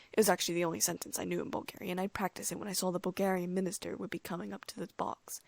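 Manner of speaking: ordinary delivery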